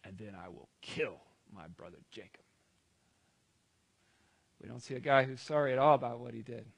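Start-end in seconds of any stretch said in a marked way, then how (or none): 0.0-2.4 low, soft, menacing plotting; hushed, low vow of revenge; scheming. Acted. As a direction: plot it darkly under your voice. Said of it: "Esau began to scheme".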